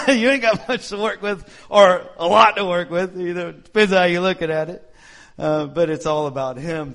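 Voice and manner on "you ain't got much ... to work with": laughing. Real, not acted. Laughing at his own joke.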